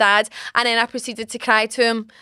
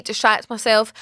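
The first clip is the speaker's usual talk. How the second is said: ordinary talk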